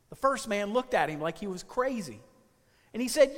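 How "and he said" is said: normal talking voice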